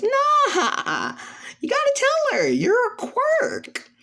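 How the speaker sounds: very high, drawn-out taunting yell; hammed-up high-pitched heckling; taunting holler. Character loudly teasing, refusing to shut up.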